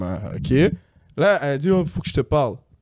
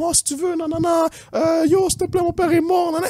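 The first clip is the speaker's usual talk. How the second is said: high wailing fake sob, much higher; mock crying; 12 semitones higher than usual. Girlfriend fakes tears to guilt him, high mock wail. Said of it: "Elle commence à pleurer".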